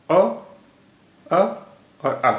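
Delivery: अ, अ, आ each sounded alone; separated vowel sounds. Shown as a demonstration. Teacher showing the vowels of consonants, separated vowel sounds. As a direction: count out the vowels अ, अ, आ with pauses. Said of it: "इसमें भी तीन syllables हैं".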